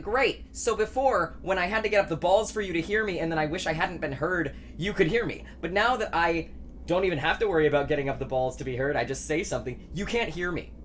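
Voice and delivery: ordinary talk, nothing marked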